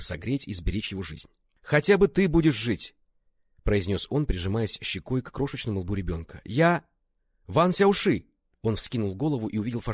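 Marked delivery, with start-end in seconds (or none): 1.6-2.9 high, loud, impassioned plea; desperate outcry. A narrator's reading. Ван Сяоши clutching the wounded baby, desperate outcry. 6.4-8.3 high, loud shout of the name; angry calling out. A narrator's reading. Enraged friend finds him with the baby, angry shout.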